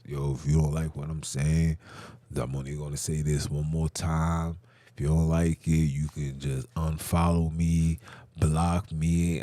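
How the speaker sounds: low, level voice for the quoted brother; retold brother. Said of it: "that's when he says".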